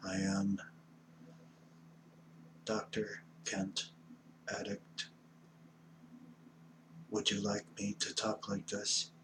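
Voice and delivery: low, soft robotic monotone. Shown as a demonstration. Offers to talk like a robot, in monotone. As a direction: hold one low note like a robot. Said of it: "want me talking like a robot".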